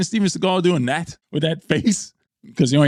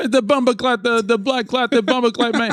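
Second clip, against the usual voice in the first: a mock Jamaican accent pitched higher; 8 semitones higher than usual. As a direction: toss out the insult in a Jamaican accent, raised pitch.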